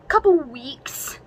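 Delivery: slow, whispered disbelief; incredulous whisper. Real, not acted. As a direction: breathe the question out in disbelief. Incredulous about the story.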